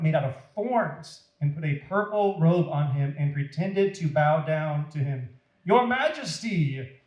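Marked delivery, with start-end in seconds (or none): 5.6-7.0 느리고 높게 비꼬는 존칭; 과장된 절하듯 조롱; mock reverence. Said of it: "they said".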